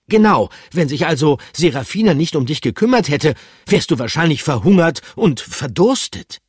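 hohe, energische Figurenstimme; hoch und bestimmt einwenden; resolute Figur. Acted. Pikandu voiced, arguing. (hohe, energische Stimme)

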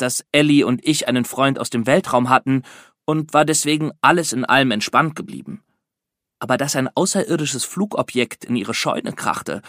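plain reading voice

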